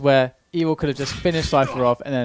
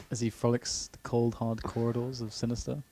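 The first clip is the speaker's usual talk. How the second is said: hushed; 3 semitones lower, 11 dB quieter than usual. Pretend. Continuing the nature documentary bit.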